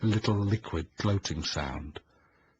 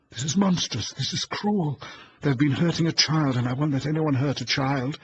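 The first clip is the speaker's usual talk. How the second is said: higher, louder rising indignation; outrage; 8 semitones higher, 5 dB louder than usual. A narrator's reading. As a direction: protest with mounting outrage. Eleanor's outraged inner speech.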